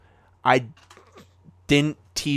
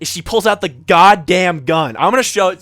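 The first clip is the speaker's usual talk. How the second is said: louder and higher, laughing; excited telling; 6 semitones higher, 11 dB louder than usual. Real, not acted. Telling friends the gun moment, loud and excited. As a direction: burst out with the big reveal.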